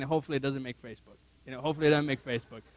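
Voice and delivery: fast, soft aside; private worried hope. Retold or imitated. Husband hoping his mistake stays off Facebook, quick aside. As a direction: rattle it off low and quick.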